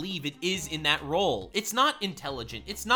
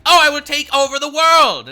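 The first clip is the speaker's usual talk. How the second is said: hammed-up evil overlord, much louder and higher; mock villain chatbot; 8 semitones higher, 12 dB louder than usual. Imitating his own chatbot bragging about world domination, hammed-up villain. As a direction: boom it out like a cartoon tyrant. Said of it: "he would be like".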